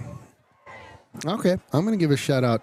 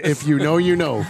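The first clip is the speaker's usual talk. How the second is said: ordinary talk, nothing marked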